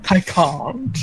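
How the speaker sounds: put-on British accent; lightly hammed-up British refusal. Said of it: "he had the British accent".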